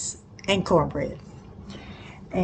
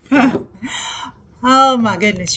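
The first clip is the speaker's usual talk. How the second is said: laughing; 4 semitones higher, 6 dB louder than usual. Real, not acted. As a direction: laugh burst into exclamation. Laughs recalling flour mess.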